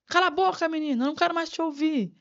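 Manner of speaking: high, sharp scolding mother, imitated. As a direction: snap it at the child like an impatient parent. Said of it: "Não falar assim". (mãe ralhando, imitada e aguda)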